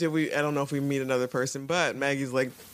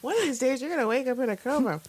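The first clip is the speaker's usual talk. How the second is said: quoting Cordelia; 11 semitones higher, faster than usual. Retold or imitated. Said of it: "when she used the".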